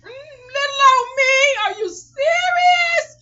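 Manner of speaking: very high, loud drawn-out squeal; hammed-up slow "who, me?" outrage; exaggerated disbelief. Mock disbelief at being ganged up on.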